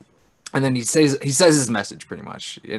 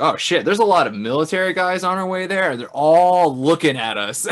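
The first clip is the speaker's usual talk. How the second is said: panicked Klaatu, higher and louder, slowing at the end; alarmed alien spotting soldiers; voicing Klaatu; 7 semitones higher, 6 dB louder than usual.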